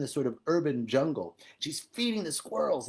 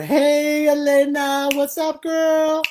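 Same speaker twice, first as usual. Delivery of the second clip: high, loud call to a friend; light up greeting a viewer; excited greeting; 12 semitones higher, 9 dB louder, slower than usual. Real, not acted. Host to a viewer joining the live chat, loud and high.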